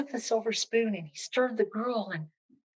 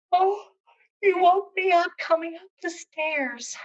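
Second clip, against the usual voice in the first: feigned just woken; 9 semitones higher, 5 dB louder than usual. Acted. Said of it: "she said for an excuse".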